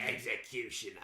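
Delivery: Executioner in an Aussie accent; Australian accent. Shown as a demonstration. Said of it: "what you think of my Aussie accent".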